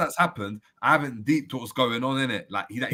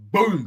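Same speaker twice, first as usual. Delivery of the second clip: loud, high-pitched "boom"; imitated impact noise; 10 semitones higher, 8 dB louder than usual. Showing friends how the kick landed, loud imitated impact. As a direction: punch it out as a big sound effect. Acts out the wrestling kick landing.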